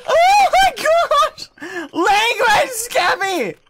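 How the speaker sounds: high loud panicked scream; terrified screaming. Real, not acted. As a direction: scream as the monster attacks. Monster attacks, screaming.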